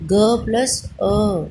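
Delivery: each sound drawn out slowly; slow spelled-out sounds. Shown as a demonstration. Teacher to children, slowly sounding out क plus अ. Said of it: "sound आ रही है ना अ की".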